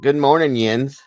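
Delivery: high, loud Pittsburgh greeting; Pittsburgh accent. Retold or imitated. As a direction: greet them like a Yinzer. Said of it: "I love it when she says it".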